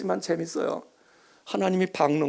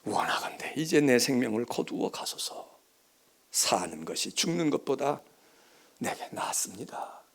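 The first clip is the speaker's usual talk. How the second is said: hushed, breathless whisper, much softer; whispered prayer; 7 semitones lower, 6 dB quieter than usual. Acted. Preacher voicing Jonah's death wish, whispered. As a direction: breathe the plea to die.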